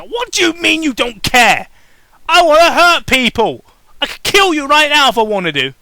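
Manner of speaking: furious high shout; angry yell. Acted. Villain pony yelling her threat. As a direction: scream it at him in rage. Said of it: "she yelled".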